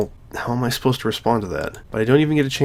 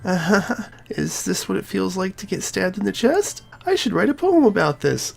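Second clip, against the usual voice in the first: hurt Sayori; 6 semitones higher than usual. Acted. Sayori hiding her hurt from her friend, high and strained. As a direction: force brightness through the pain.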